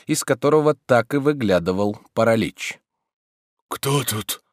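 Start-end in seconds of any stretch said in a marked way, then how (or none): 3.7-4.4 a touch of sleepy hoarseness; hoarse. A narrator's reading. Master half woken by his servant, slightly hoarse. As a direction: ask it a little hoarsely. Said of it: "спросил он хриплым голосом".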